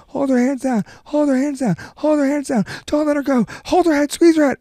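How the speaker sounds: high-pitched, repetitive coaching yell; yelling sideline dad. Retold or imitated. Imitating a dad at his daughter's match, high urgent yelling. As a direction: imitate a dad hollering orders matside. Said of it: "this dad was like".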